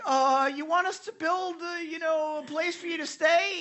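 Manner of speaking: high, eager, flustered Peter blurting an offer. Retold or imitated. Peter blurts to Jesus on the mountain, high and flustered. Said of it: "Peter's like".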